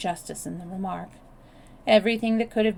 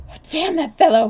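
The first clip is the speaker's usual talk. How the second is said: high, loud furious outburst; wrathful exclamation; 9 semitones higher, 12 dB louder than usual. A narrator's reading. Bill, panting, cursing the escaped intruder angrily. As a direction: curse the escaped fellow in a rage. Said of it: "he exclaimed wrathfully".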